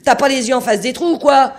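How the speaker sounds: hurlement furieux, voix bien plus aiguë; engueulade hurlée. A narrator's reading. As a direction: crie ta fureur contre l'iceberg. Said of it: "hurla-t-il à l'amas de glace". (le capitaine engueule l'iceberg en hurlant)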